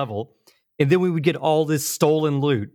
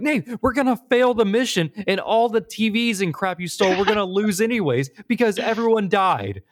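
higher, pushed retelling of a heated rant; mock frustrated yell; 8 semitones higher than usual. Player scolding friend for stealing instead of rescuing, mock angry. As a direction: scold your partner, hammed-up. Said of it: "And I'm like".

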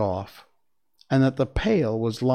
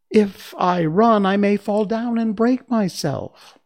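much higher, louder small frightened voice; high princess voice; 9 semitones higher, 6 dB louder than usual. Acted. China princess explaining her fear of breaking, high and small. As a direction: sound tiny and fragile.

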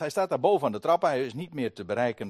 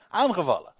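ordinary talk, nothing marked

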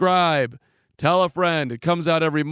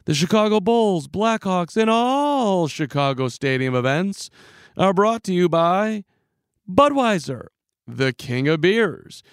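higher, lightly hammed PA announcer; Tommy Edwards announcer read; 5 semitones higher than usual. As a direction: recite it like the arena PA guy. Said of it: "I can still remember the script".

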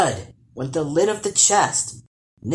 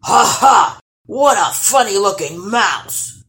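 loud mocking troll laugh; scoffing laugh; 6 semitones higher, 8 dB louder than usual. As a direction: laugh at the tiny intruder. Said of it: "scoffed the troll".

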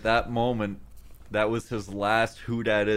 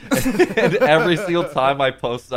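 usual speaking voice

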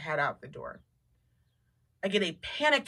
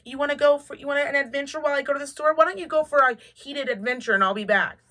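mock sarcastic to dog; 6 semitones higher, 3 dB louder than usual. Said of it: "I was like".

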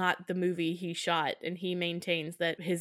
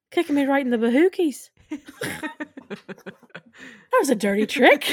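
higher and louder, playful reproach; mock sultry scolding; 5 semitones higher, 7 dB louder than usual. Sharon scolding Martin, hammed-up playful reproach. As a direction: tease him for kicking her. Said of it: "Sharon says".

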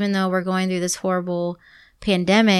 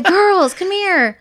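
high, raised summoning voice; acted high call to the girls; calling the kids; 11 semitones higher, 3 dB louder, much slower than usual. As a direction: imitate a mom calling her kids over. Retells calling her kids in.

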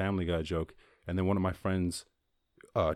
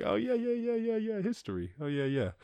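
dismissive; 13 semitones higher, 3 dB quieter than usual. Retold or imitated. Himself brushing off parents.

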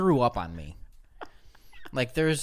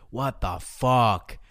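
slow, drawn-out, louder "what the fuck"; irritated actor quote; 5 dB louder, much slower than usual. Retold or imitated. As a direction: drag out the annoyed curse like a fuming celebrity. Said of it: "he's like".

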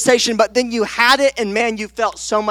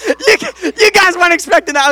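laughing; 8 semitones higher, 3 dB louder than usual. Real, not acted. Laughing-crying after the fit.

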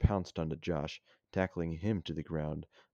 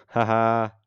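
mock laugh; 5 semitones higher, 12 dB louder than usual.

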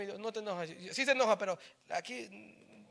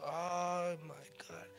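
slow, soft, drawn-out exasperated sigh; deep exasperated sigh; 7 dB quieter, much slower than usual. Pretend. Imitating Jesus sighing at a silly question, slow and soft. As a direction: sigh it out like a tired old man. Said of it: "Suspiró profundamente diciendo".

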